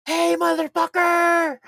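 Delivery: long, drawn-out high holler; imitated yelling. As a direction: holler down from a high floor, stretched out. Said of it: "Digga just yelling at her".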